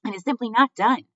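high, loud, scandalized aunt voice; Aunt Florence. A narrator's reading. Aunt protesting from the crowd, scandalized. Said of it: "came Aunt Florence's voice from the crowd".

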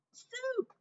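high defiant cry of soup; shout. A narrator's reading. Cook defying Louise, shouting high. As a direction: Cook blurts the forbidden word. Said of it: "shouted Cook".

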